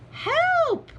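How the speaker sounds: high-pitched storybook panic; cry for help. Acted. Witch fleeing the dragon, high cry for help. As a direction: call out high and desperate. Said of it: "cried the witch".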